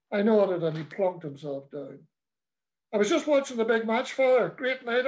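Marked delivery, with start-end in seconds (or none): 2.9-5.1 whimpering. Acted. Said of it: "I whimpered".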